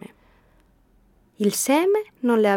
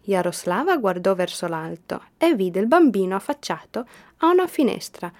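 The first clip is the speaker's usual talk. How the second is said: ordinary delivery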